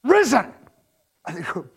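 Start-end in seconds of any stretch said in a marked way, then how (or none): 0.0-0.5 Risen shouted high and loud; ringing shout of Risen. Retold or imitated. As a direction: shout Risen across the garden. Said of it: "he shouts it like".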